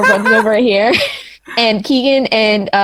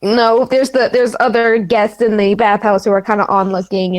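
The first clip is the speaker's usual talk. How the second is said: ordinary talk, nothing marked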